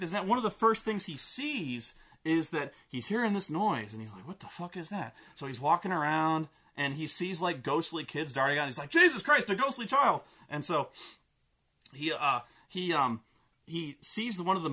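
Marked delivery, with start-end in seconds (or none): 4.3-5.1 quietly puzzled mock reaction; hushed puzzlement. Character reacts to a strange noise, hushed puzzlement. 8.9-10.2 high, louder yelp of alarm; mock startled. Character sees ghostly kids, mock startled.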